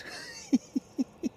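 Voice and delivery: high, breathy stifled giggle; real delighted laugh, kept small; gleeful giggle. Real, not acted. Detectorist delighted by silver in the mud, breathy giggle.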